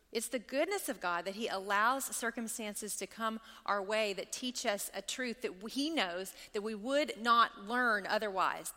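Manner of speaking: usual speaking voice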